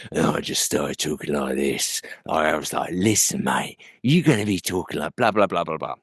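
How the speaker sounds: low, soft tough-guy London voice; Jason Statham. Acted. Voice actor recreating his audition for a rough prisoner, low tough guy. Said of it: "break out this Jason Statham impersonation".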